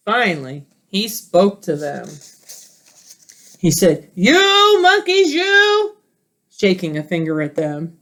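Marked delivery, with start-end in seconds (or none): 4.2-6.0 peddler demanding caps. Acted.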